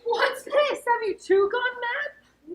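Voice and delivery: high, loud, alarmed questioning; startled rebuke. Acted. Lady interrupting her clown's mad reading, startled rebuke. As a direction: cut in sharply, incredulous.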